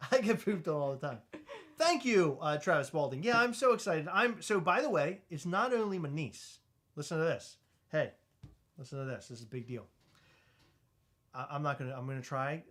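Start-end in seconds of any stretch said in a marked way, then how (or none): none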